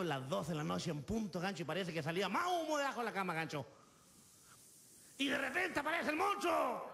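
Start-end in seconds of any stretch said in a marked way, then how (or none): 5.2-6.9 slow, higher dramatic shout; dramatic announcement. Acted. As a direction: announce the monster's sudden entrance, drawn out. Announces the monster in his story. (grito dramático, lento y agudo)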